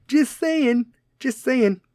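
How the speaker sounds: high, loud sing-song tag. Acted. A comedian needling the crowd, high sing-song. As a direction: toss it off in a high teasing lilt. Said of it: "tag line".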